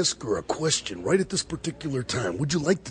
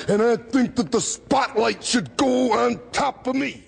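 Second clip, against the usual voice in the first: boastful shout; 9 semitones higher, 4 dB louder than usual. Real, not acted. Boasting promo climax.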